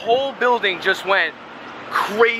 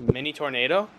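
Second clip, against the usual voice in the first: ordinary delivery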